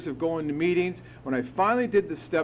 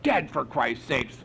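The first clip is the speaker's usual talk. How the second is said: high, drawn-out indignant outburst; retold belligerent reply; belligerent retort; 9 semitones higher, slower than usual. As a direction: snap back at the sponsor, offended. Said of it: "I was so belligerent".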